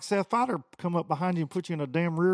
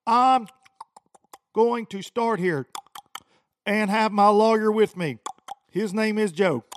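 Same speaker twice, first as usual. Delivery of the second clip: mocking a prissy angler; 4 semitones higher, 7 dB louder than usual. Mocking an angler who brings lawyer.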